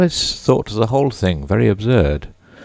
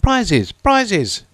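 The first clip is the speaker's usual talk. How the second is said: high, eager crowd cry; excited calling out. A narrator's reading. Party crowding round Alice, calling out in high mock excitement. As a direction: call it out, a touch of clamour. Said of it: "calling out in a confused way".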